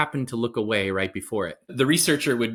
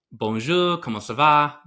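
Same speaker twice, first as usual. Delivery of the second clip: French greeting, a bit louder; attempted French accent; 3 semitones higher, 5 dB louder than usual. Customer greeting a French bread shop, attempted French accent. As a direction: have a go at a lilting French accent. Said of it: "Pardon my accent".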